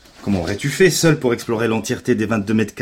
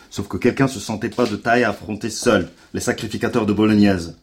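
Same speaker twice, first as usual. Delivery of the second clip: usual speaking voice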